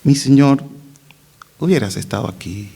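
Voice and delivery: mock-composed address, falling away low; composed, wrong way. Preacher shows how bereaved sister would not speak, composed. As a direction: play grief far too politely. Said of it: "¿Ustedes creen que habló así?".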